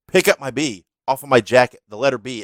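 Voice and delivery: retold angry demand. Wrestler leaning out of the ring, barking at his manager, mock-angry. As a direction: repeat the order like he's fed up. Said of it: "like he's all angry at me".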